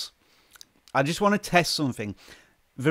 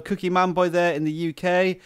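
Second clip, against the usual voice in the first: ordinary delivery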